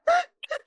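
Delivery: high, sharp sobbing intake of breath; choked-up gasp, reenacted; crying gasp. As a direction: imitate a tearful gasp.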